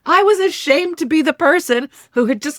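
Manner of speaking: emotional recollection. Real, not acted. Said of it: "I'm getting emotional just saying this".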